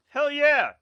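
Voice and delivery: high, loud whoop of joy; excited cheer. Real, not acted. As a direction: cheer it out like a win. Cheering for croquettes.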